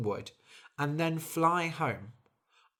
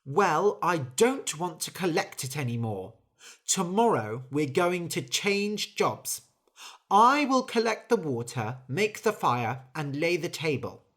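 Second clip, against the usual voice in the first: louder, higher, bossy; firm angry orders; 4 semitones higher, 4 dB louder than usual. Acted. Angry bird ordering the mouse and sausage to swap jobs. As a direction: lay down the law.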